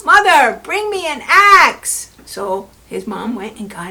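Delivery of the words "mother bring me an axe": loud, high, slow shout downward; yelled. Acted. Jack calling down the beanstalk to his mother, loud yell. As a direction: yell down from far above. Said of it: "he yelled down".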